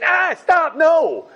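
high, loud shriek of alarm from a talking light switch; hammed-up high yell; mock scream. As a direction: cry out to stop someone, playfully frantic. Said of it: "the light is gonna scream at you".

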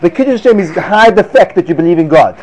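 normal talking voice

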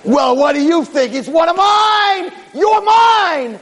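high, slow loud shout; gloating Satan. Acted. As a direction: claim him, crowing. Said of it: "And the Satan's like".

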